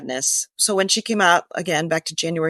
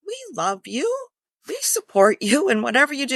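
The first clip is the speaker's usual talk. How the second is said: lightly voiced perfect-wife reassurance, higher; a hint of a Stepford wife saying the right thing; 9 semitones higher than usual. Mother to her newly out daughter, higher mock Stepford-wife voice. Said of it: "I was like a Stepford wife".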